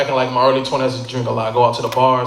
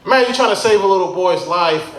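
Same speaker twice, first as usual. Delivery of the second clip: usual speaking voice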